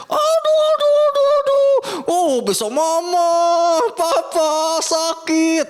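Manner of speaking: put-on high, loud childish wailing; acted pain. Child pricked by a thorn crying to parents, mock wailing. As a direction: wail to parents like a hurt child, hammed-up. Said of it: "Sakit ya".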